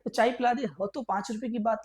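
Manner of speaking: ordinary talk, nothing marked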